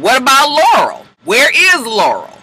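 high, stretched questions; pointed questioning. Acted. Recapper wondering about Laurel's callbacks, pointedly curious. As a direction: raise the pitch and draw out the name.

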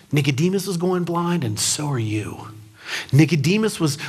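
ordinary talk

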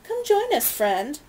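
high, loud false cheer; sarcastic friendly greeting; mock friendly call. Bellingham calls, falsely friendly.

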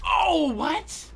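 loud, high-pitched disbelief; startled outburst. Real, not acted. Player reacting to a sudden in-game turn, startled outburst. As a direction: cry out in real shock.

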